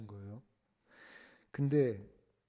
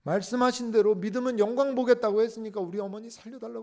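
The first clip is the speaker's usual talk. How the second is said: high, loud, desperate prayer; shouted crying prayer; 10 semitones higher, 9 dB louder than usual. Retold or imitated. Boy crying out over his dead mother, loud wailing prayer. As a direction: wail the prayer at full voice. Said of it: "울면서 소리를 치면서 막 기도를".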